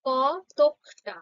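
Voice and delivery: কতকটা said very slowly as কত-ক-টা; a teacher slowly splits the word into syllables; slow syllable demo. Shown as a demonstration. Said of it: "যেমনটা উচ্চারণ করছি দেখো".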